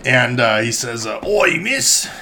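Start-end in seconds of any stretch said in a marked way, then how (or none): 1.3-2.1 hammed-up Cockney. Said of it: "Cockney was what I was going for".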